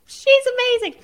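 high, loud gushing praise; gushing defence. Retold or imitated. Defending her friend to commenters, high loud gush. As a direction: rave about her like a fan.

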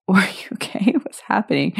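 imitating a groggy husband in a whisper; whispered, sleepy mock concern; half-asleep husband. Husband waking up to his crying wife, half-asleep whisper. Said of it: "Half asleep and stuff".

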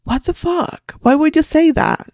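retold disbelief, pitched high; an incredulous what-the-fuck, high and sharp; high, stunned reaction to a careless nurse; shocked outburst. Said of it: "I'm like".

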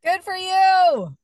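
loud, high, slow cheer. Real, not acted. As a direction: really cheer her on. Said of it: "cheering friend's choice".

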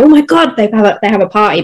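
put-on quick, excited kid-like outburst, settling fast; mock excitement. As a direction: imitate thrilled kids celebrating. Voices kids delighted at dog's success.

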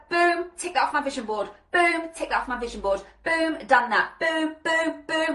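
punchy, energized exclamations; high-energy boom. Acted. Coach modelling ticking off the vision board, high-energy. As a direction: hit each boom with get-it-done energy. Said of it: "that's the energy you wanna be in".

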